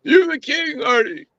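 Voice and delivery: high, squeaky mock surrender; mock whimper. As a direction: squeal it in defeat. Acting out forced submission.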